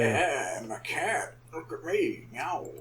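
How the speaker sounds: small, soft, low mock-cat voice; cat impression. Doing a cat impression for co-hosts, soft mock cat. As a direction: play a cat quietly, deadpan and underplayed. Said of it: "That's my cat impression".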